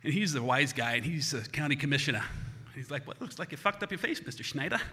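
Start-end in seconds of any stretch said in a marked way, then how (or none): 3.1-4.8 put-on wisecracking doctor with a Boston accent. Doc greets injured eight-year-old, wry Boston accent. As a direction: drop the R's like a Boston wise guy. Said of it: "he's from Boston".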